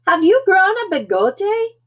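high, loud little character voice; high-pitched hammed-up question; tiny dog. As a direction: demand it in a high pipsqueak voice. Smallest dog questions the kitten.

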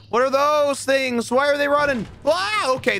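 high, alarmed questions; startled by running creatures. Real, not acted.

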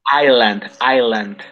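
island said as "ajland", silent S; teacher repeating island slowly; model pronunciation. Shown as a demonstration. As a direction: stress the I of island, no S sound. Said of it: "The pronunciation for this one".

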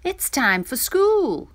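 high, loud, slow call; mother waking child. A narrator's reading. Mother rousing Dudu for school, calling out high and slow. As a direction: call it out slowly to a sleepy child. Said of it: "said her mother".

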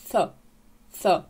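«ф» as a th sound, tongue between the teeth. Shown as a demonstration. Teacher models the first sound, th with exhaled air. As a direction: breathe out a th for «ф». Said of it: "поставити язик між зубами".

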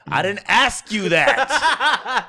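high, loud, drawn-out indignant yell; furious comeback, hammed up for laughs; retold furious outburst. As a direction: re-enact blowing up, stretching the words. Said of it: "And I fucking got furious".

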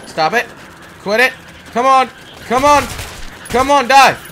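loud, high combat frustration; urgent shouting. Real, not acted. Player fighting an enemy, urgent frustrated shouting. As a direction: urge the enemy to drop, loudly.

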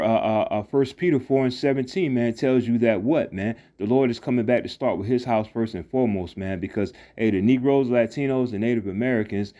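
ordinary delivery

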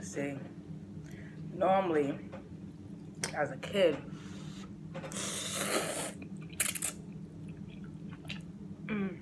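between bites of noodles; speaking over a noodle bowl; talking while eating. Real, not acted. Seen 0: Lifts noodles toward mouth while talking; bite only at end.

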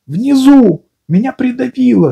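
high squeezed voice, pinned down; squashed voice. A narrator's reading. Piglet, stuck below, answers Pooh in a squashed voice. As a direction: sound squashed under a weight. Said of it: "сказал Пятачок придавленным голосом".

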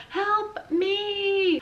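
high panicked plea; frightened cry. A narrator's reading. Rita cries for help in terror.

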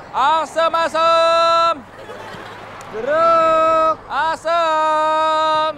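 loud market hawker cry; calling out wares. Acted. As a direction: bellow the fruit names to shoppers. Vendor crying his wares.

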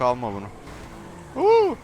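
usual speaking voice

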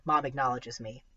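plain reading voice

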